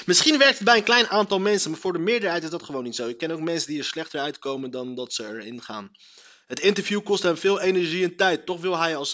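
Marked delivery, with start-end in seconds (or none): none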